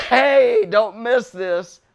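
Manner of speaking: loud, high hey, then a slow don't miss this; calling out. Pretend. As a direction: grab everyone's attention. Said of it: "somebody in the center going".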